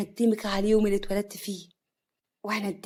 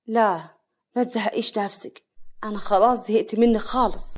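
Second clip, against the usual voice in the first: usual speaking voice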